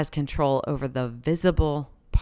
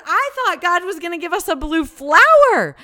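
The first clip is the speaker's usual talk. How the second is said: high, loud, awestruck exclamation; bursting with wonder; amazed; 12 semitones higher, 8 dB louder than usual. Retold or imitated. Mom telling her girls about the butterfly, amazed and loud. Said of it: "I said".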